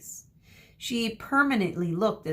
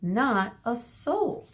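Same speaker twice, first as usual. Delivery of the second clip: slow and drawn out, rising; slow dramatic reveal; 5 semitones higher, much slower than usual. A narrator's reading. Reader teasing children that nobody is there, slowly. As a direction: hang on the word soul.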